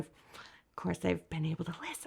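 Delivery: hushed whisper, sharing a secret; whispering on the phone. Real, not acted. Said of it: "I don't know if I have to whisper".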